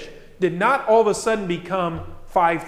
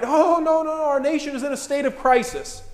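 worked-up mock panic; 8 semitones higher than usual. Voters hearing election talk of crisis, mock panic. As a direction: mimic a nation in a flap, pitched high. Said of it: "gets the rest of us all worked up".

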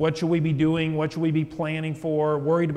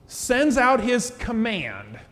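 slow, raised delivery landing on "command"; stress on command; 5 semitones higher, much slower than usual. Real, not acted. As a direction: draw out "command" with deliberate emphasis at a higher pitch. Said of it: "I want to really emphasize that word".

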